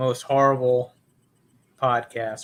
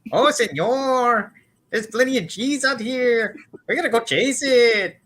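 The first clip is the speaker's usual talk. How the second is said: put-on Mel Blanc Mexican accent; 10 semitones higher than usual. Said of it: "the Mexican accent, specifically as done by Mel Blanc".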